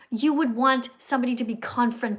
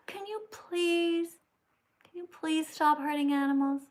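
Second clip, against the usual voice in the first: mock timid plea, higher and softer, very slow; meek pleading; 4 semitones higher, 5 dB quieter, slower than usual. Mocking timid vegans asking abusers to stop, meekly. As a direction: mimic a feeble polite vegan begging. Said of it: "This is not the time to be saying".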